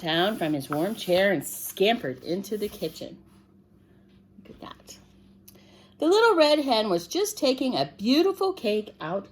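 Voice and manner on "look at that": hushed whispered look. Real, not acted. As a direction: whisper to point it out. Whispered aside.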